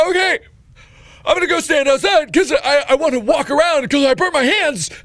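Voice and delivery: high, loud, hammed-up agony; agonized shouting. Burnt patient told to sit, high agonized shouting. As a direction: shout it through mock pain.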